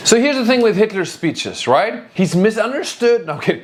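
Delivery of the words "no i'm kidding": normal talking voice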